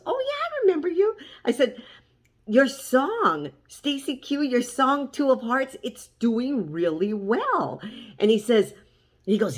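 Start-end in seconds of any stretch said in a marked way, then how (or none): 0.1-1.2 quick, much higher-pitched retold recognition; high, hurried burst of acted recognition; pleased recognition. Acting his pleased recognition. 2.5-7.8 higher-pitched retold enthusiasm, slowing at the end; impressed fan, lightly re-enacted; excited congratulations. Retelling congratulating him.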